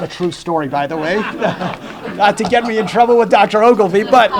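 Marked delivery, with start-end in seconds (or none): 1.2-4.3 fast, louder, laughing. Real, not acted. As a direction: joke it off while laughing. Laughing disclaimer.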